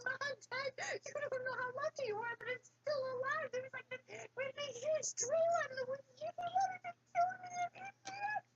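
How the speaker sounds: very high, breathless mock panic; panicked son. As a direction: squeal it out as if fleeing for your life. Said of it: "sweating and panting".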